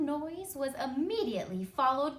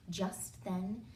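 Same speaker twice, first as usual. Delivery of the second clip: ordinary delivery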